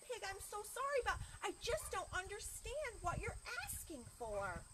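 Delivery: high, regretful librarian voice; apologetic librarian. Acted. Librarian tells Pig she doesn't understand, apologetic. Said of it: "very friendly children's librarian looked".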